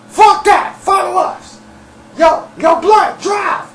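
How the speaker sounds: very high, loud mock shout; shouted orders. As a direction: imitate a man yelling urgent orders in a high voice. Acts out Dirty ordering everyone around.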